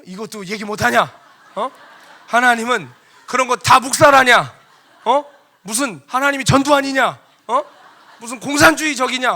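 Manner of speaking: loud, high indignant shouting; acted-out angry challenge; confrontational protest. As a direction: argue back at full volume. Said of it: "따지는 거예요".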